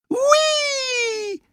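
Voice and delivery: high excited squeal; child whooping over a puddle; gleeful whee. A narrator's reading. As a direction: shout it with joy mid-jump. Seth jumps over puddle.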